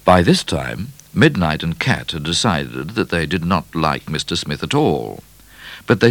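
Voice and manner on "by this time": plain reading voice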